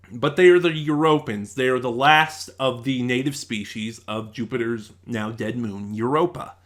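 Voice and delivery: usual speaking voice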